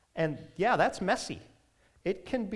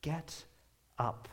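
put-on tender words to a child; 3 dB quieter, slower than usual. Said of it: "And Jesus says".

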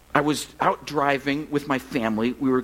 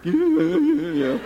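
high, loud mock children's noise; kids in back seat; 12 semitones higher, 5 dB louder than usual. Kids in the back seat chiming in, high and loud. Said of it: "And my kids are in the back".

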